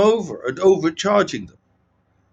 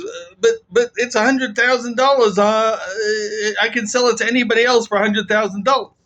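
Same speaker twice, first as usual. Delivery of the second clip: flustered seller; 6 semitones higher than usual. Retold or imitated. Seller pleads, stammering.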